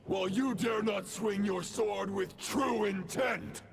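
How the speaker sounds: menacing villain. Acted. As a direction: taunt him like a dark knight. Villain speech in a boss fight.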